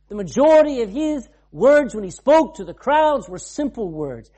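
climbing preacher's call; preaching loud. Acted. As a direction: lift it to the back row. Preacher rising to full force.

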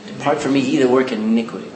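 low, firm reading of the real verse; correct version. Shown as a demonstration. Preacher correcting his misquote with the real words. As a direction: drop low and set the record straight. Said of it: "Is that what he said?".

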